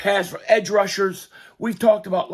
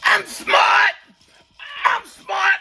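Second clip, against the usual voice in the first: mock dimwit voice; 12 semitones higher, slower than usual. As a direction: shout it like a proud fool. Mocking a dim character.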